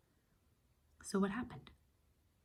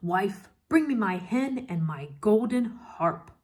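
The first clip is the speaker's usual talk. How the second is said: louder, higher booming order to his wife; giant's loud demand; 4 semitones higher, 8 dB louder than usual. Acted. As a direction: command the wife to fetch them. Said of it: "the giant said".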